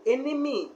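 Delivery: enemy with the stress on the last syllable; enemy, leaning on the final vowel; enemy said with a stressed ending. Shown as a demonstration. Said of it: "Guans also like stressing on final sounds".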